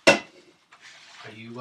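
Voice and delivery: usual speaking voice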